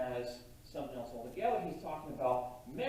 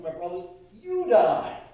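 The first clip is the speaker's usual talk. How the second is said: preaching; 4 semitones higher, 10 dB louder than usual. Acted. Preaching the verse with force.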